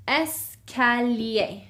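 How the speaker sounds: Escalier slowed right down, "es-ka-lyay"; Escalier, stretched out syllable by syllable for learners; slow teaching repeat of Escalier; slow French model. Shown as a demonstration. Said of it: "This means stairs".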